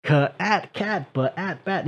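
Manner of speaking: a mock kids' drill, blending each sound into the word; phonics sounding out. Shown as a demonstration. Host mocking an adult doing phonics drills, sounding words out. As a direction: sound out "k-", "at", "cat", then "at", "bat" piece by piece.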